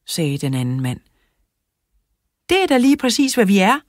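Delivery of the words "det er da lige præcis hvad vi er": high, loud eager reply; called out. A narrator's reading. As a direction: pipe up loudly, starting high. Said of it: "råbte Lara".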